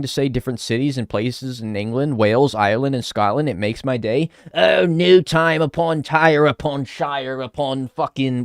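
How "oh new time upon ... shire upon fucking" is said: drawn-out, higher caricature British voice; mock British accent. Streamer mocking British town names to chat, mock British accent. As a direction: spoof British place names in a slow British accent.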